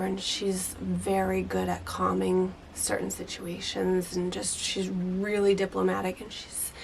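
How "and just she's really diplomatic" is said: ordinary talk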